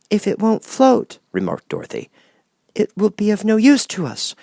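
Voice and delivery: put-on a curious young girl asking; Dorothy. A narrator's reading. Dorothy asks the Wizard how, lightly girlish.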